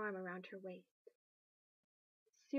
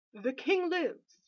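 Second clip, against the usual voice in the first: loud, slow, ringing announcement; a prince calling out to the crowd that the king lives; 7 semitones higher, 12 dB louder, much slower than usual. Acted. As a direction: proclaim it across the crowd with relief.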